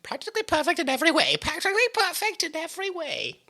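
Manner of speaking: high, fast sing-song Mary Poppins. Retold or imitated. Mary Poppins quote sung high.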